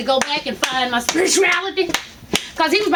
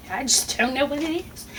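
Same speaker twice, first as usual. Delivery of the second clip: mimicking doctor; 4 semitones lower, 6 dB quieter than usual. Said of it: "that's how he's talking".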